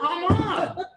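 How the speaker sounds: a helpless laughing plea; laughing exasperation. Retold or imitated. Caught out in class, laughing come on to the rabbi.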